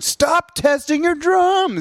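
high slow shouted complaint; annoyed yell. Retold or imitated. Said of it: "He's like".

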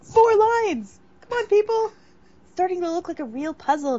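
high, loud excited cheer. Acted. Excited host urging listeners on.

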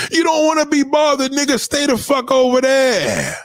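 high, exasperated dismissal; fed-up, high-pitched rebuff; indignant telling-off. Acted. Telling off the absent relative.

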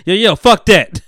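loud mock Ghostface voice; Ghostface impression. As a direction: bark it out like [name]. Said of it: "she got Ghostface".

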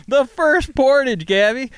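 high, loud disbelief; amazed exclamation. Real, not acted. Host marveling at the coincidence.

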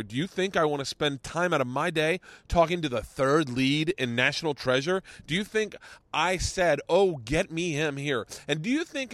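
indignant rant, pitch climbing as it builds; Ronnie's tirade. Retold or imitated. Radio host telling off his movie-star guest, mounting indignation. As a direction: imitate an irate host tearing into a guest. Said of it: "Ronnie breaks him fucking off".

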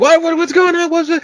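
very high, loud panicked questioning; frantic high-pitched waking alarm; startled panic. Retold or imitated. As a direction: imitate a wife startled awake. Startled wife woken by screaming.

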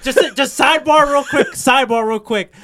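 words broken by giggles; laughing. Real, not acted. Host still laughing while starting a sidebar.

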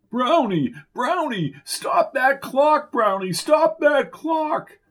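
high, drawn-out yelling to stop the clock; slow, desperate shouted orders; coach shouting. Acted. Hallucinating coach yelling at game.

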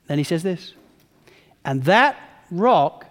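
loud, driving sermon delivery; full-force preaching. Acted. Pastor exhorting the congregation, preaching loud. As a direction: preach it out to the whole room.

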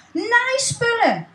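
high, drawn-out cry of delight; thrilled. Acted. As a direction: stretch it out with joy. Stan thrilled by sewing things. (Stan die verrukt zijn naaispullen ziet)

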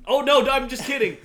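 high laughing burst. Real, not acted. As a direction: laugh it out loud. Laughing at own joke.

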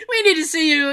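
laughing high voice. Real, not acted. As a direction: laugh while mocking the clinic. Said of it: "laughing through quote".

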